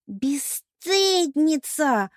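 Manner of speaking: high, extremely drawn-out accusation; flaring indignation. A narrator's reading. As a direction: stretch the insult in theatrical outrage. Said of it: "взвилась Пенелопа". (возмущённо, нараспев)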